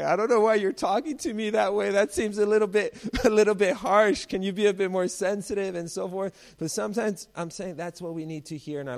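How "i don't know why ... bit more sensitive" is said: high, loud, indignant whine; mock offended. A preacher imitating an offended listener, much higher and louder. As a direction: play the touchy churchgoer, high and loud. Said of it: "maybe I would have been like".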